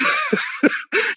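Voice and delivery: rire aigu qui éclate; high burst of laughter. Real, not acted. Shock of seeing Ginger.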